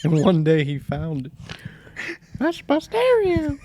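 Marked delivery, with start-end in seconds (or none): 2.4-3.6 high, slow outraged claim over a stolen stereo; imitating an indignant old friend. Friend recognizing his stolen stereo, high and slow. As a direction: play the wronged buddy spotting his stereo.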